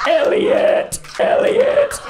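high, strained alien croak calling the name. Retold or imitated. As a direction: call out Elliot like the little alien. Imitating E.T. calling Elliot.

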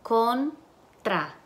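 contra split as "con... tra" with a pause; syllable by syllable. Shown as a demonstration. Teacher asks for the syllables, syllable by syllable. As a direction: break contra into two separate syllables. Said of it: "Y las sílabas".

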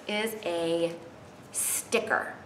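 plain reading voice

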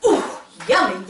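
plain reading voice

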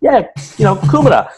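high, loud re-enacted insistence; mock insistence. Customer explaining kumara to shop worker, mock insistence.

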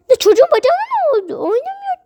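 high, loud and fast alarm; panicked parent. Pretend. Doll parent panics over child. (yüksek ve hızlı panik)

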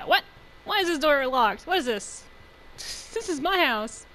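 real burst of loud disbelief; startled yell. Real, not acted. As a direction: yell it out without meaning to. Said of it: "I just yelled really loud".

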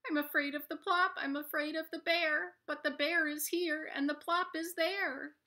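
high, quick frightened rabbit; scared little rabbit. Acted. Littlest rabbit fretting to himself between bear and plop, high. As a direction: think aloud fast like a worried bunny.

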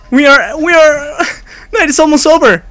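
high, nervous begging; frantic pleading. Real, not acted. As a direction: plead desperately to make it through. Begging as night nearly ends.

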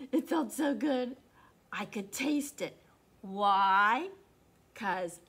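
put-on lively read-aloud for kids; animated storybook narration; story reading. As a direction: read it like a picture book to children. Reads a picture book aloud.

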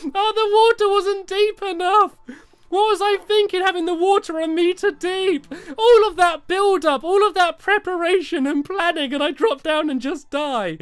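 high, loud wailing complaint; whiny despair, easing off towards the end; exasperated lament. Real, not acted. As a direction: bemoan all that wasted effort. Lamenting the failed jump.

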